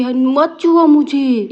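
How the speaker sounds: put-on high, slow whine of a child; mock childish whine, don't touch me; whiny child. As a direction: whine like a touchy little girl. Sister whining at sibling.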